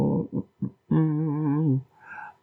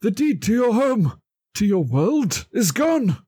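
much higher, dramatic puppet voice; James breaking bad news; 11 semitones higher than usual. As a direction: deliver doom to a friend, hammed up. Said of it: "he turns to Kermit and goes".

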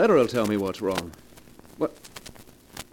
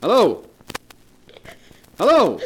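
high and louder each time; building impatient hellos; 10 semitones higher, 7 dB louder than usual. Acted. Husband demanding an answer from a silent caller, rising impatient shouts. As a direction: shout into the dead line.

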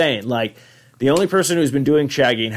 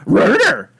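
high, loud cartoon dog voice; garbled talking-dog impression; Scooby; 12 semitones higher, 5 dB louder than usual. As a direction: yelp the words like a cartoon dog.

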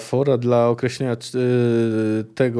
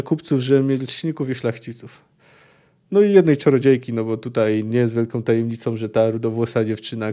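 usual speaking voice

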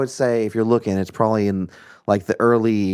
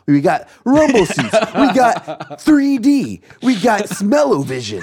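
high, loud hype announcer voice; 9 semitones higher, 4 dB louder, slower than usual. Pretend. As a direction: sell it like a cheesy ad. Hyping theater features as ad.

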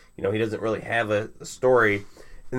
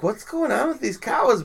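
high, bewildered question; baffled; 13 semitones higher than usual. Retold or imitated. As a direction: ask it in mock disbelief. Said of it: "So I'm like".

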